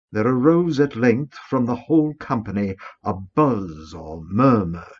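ordinary delivery

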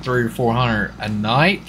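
ordinary delivery

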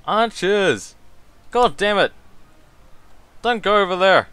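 loud, high commanding yells; shouting orders. Real, not acted. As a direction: bellow orders at the troops. Yelling orders at troops.

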